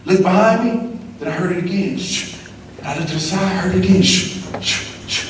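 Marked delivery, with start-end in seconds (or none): 2.0-2.3 soft imitated paper swish; page-turning sound. Test-taker hears pages turn behind him, as a soft imitated swish. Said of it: "sound of everybody changing pages". 4.0-5.2 imitated swish of paper; a drawn hushing "shh" for a turning page; page-turning sound. Test-taker hears classmates changing pages, as an imitated paper swish. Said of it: "sound of everybody changing pages".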